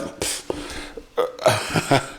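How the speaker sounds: soft, breathy laugh while searching for words; stumped chuckle. Real, not acted. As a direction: puff of air, then a quiet chuckle.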